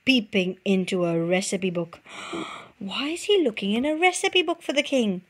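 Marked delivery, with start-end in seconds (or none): none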